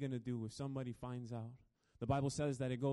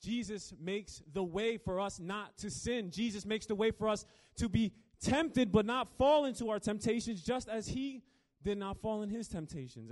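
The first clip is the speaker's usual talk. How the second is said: higher, louder preaching, pressing forward; preaching at full force; 7 semitones higher, 6 dB louder than usual. Acted. Preacher driving home the point to the congregation, fervent. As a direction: build the point with rising fervor.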